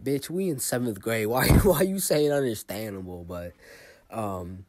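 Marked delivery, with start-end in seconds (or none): 0.0-3.2 loud, laughing incredulous outburst; laughing disbelief. Retold or imitated. Looking back on a classmate's reply, laughing disbelief. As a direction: laugh at how absurd it was. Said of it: "looking back at it".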